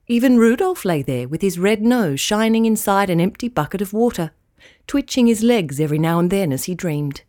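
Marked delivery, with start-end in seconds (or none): none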